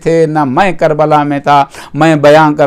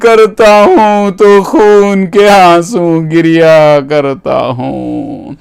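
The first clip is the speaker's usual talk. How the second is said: tearful wail; 3 semitones higher, much slower than usual. Retold or imitated. Preacher voicing the weeping Imam grieving Zainab's captivity, slow wail. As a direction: wail slowly, stretching every word into a mourning cry. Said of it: "روتے ہوئے امام نے کہا".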